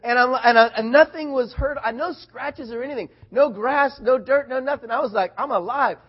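loud, high, amazed excitement; reliving it with rising amazement; fired up. Retold or imitated. Boy finds himself unscratched after the fall, loudly fired up. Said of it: "I was so fired up".

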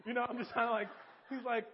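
usual speaking voice

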